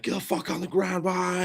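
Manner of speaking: high-pitched mock yell of cops barking orders; retold screaming. Cops screaming at a suspect, mild mock yell. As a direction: bark it like an amped-up officer. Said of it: "guys came in screaming".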